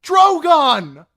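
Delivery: high, loud scream of the dragon's name. Acted. Dany calling her dragon across the pit: high, loud scream. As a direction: call him with everything. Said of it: "she screamed".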